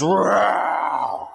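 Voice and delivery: a playful little roar; half-hearted lion roar; mild mock roar. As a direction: growl the word like a lion. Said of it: "lets out a big, big roar".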